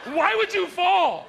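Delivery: high, loud, slow demand; exasperated wife. Acted. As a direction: snap the question like a fed-up wife. Wife's angry question.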